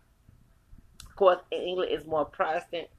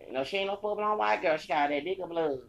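usual speaking voice

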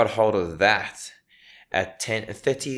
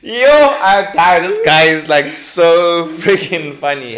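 laughing; 8 semitones higher, 10 dB louder, slower than usual. Real, not acted. Cracking up over the clip.

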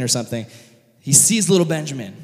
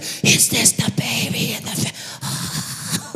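high, very slow breathy creep; hammed-up weirdo voice; creepy governor; 6 semitones higher, much slower than usual. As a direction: draw it out in an eerie whispery coo. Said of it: "creepy governor, this bald freak is like".

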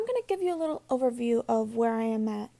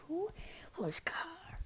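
whispered aside; 5 semitones higher, 10 dB quieter, much slower than usual. Real, not acted. Puzzled aside.

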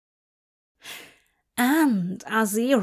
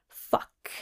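breathy whisper; 5 dB quieter than usual. A narrator's reading. Crowley's inner exclamation.

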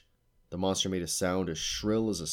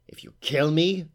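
high, louder threat; sudden menace; 10 semitones higher, 7 dB louder than usual. A narrator's reading. Witch warning the captive harpy, high and menacing. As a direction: spike it up as a threat.

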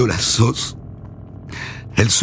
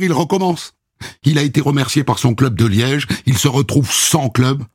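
ordinary talk

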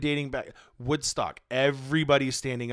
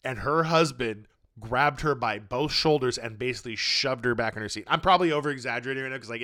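ordinary talk, nothing marked

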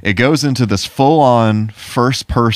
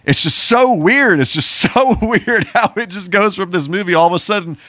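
high, loud, amazed disbelief; incredulous; 13 semitones higher, 3 dB louder than usual. Real, not acted. To his friend, laughing in disbelief at the movie. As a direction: marvel at how strange it is, voice climbing.

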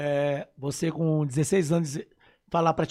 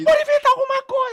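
much higher, louder insistent shout; wife demanding the trip, hammed up; mock shrieking wife; 11 dB louder than usual. Wife insists to her husband on travelling, much higher mock shout.

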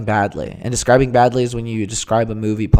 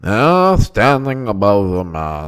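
more nasal statesman impression, slow and low; Winston Churchill; 5 semitones lower, slower than usual. Shown as a demonstration. Redoing the impression after his friend's note, nasal orator voice. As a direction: push it through the nose, ponderously. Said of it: "He had it more nasally".

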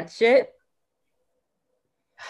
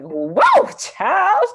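put-on louder whoa, child with a high leap on child; amazed swoon; 12 semitones higher, 9 dB louder than usual. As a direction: gush over a crush on TV. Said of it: "be like".

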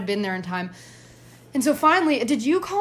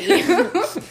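laugh; 8 semitones higher than usual. Real, not acted. Laughs at plan.

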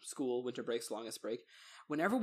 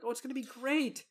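mock naive tourist; 8 semitones higher than usual. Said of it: "they're like".

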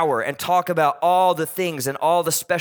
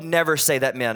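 ordinary talk, nothing marked

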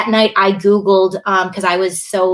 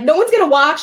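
nervous worry; 8 semitones higher, 4 dB louder than usual. Retold or imitated. Retold fear of going live.

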